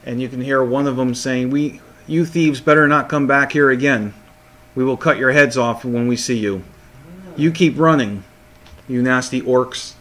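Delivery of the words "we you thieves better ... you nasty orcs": usual speaking voice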